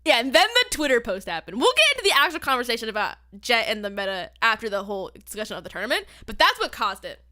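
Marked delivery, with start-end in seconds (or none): none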